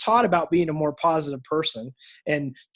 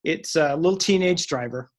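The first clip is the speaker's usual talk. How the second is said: normal talking voice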